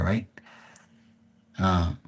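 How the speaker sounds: normal talking voice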